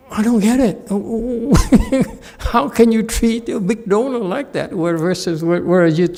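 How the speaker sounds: can't believe what the master just did; baffled disbelief. Acted. Disciple questioning the abbot in high-pitched disbelief.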